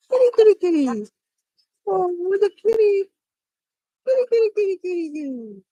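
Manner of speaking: high, sing-song kitty call; calling a cat. Real, not acted.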